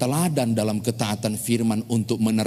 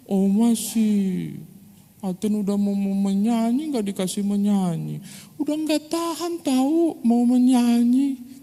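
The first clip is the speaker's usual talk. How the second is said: put-on sulky crying child; 10 semitones higher than usual. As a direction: whine through mock tears. Crying child complaining to grandma.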